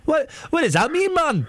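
exaggerated Geordie questioning; mock Geordie accent. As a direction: ask it in imitation Newcastle. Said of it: "when I do that accent".

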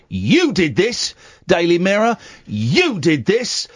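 slow, pointed "You did this"; accusing. Acted. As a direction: jab each "You" at the paper. Mock accusing the newspaper.

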